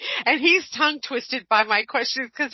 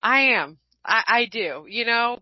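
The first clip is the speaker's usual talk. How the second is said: normal talking voice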